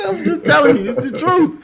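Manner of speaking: high, loud, laughing insistence; helpless laughter. Real, not acted. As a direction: insist through fits of laughter. Still cracking up with the guest.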